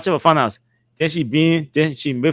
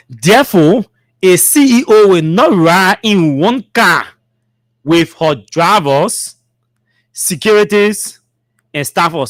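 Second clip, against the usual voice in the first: slow, measured read-aloud; emphatic, spaced-out official reading; formal statement reading; 2 semitones higher, 3 dB louder, slower than usual. Acted. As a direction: read it out deliberately, item by item.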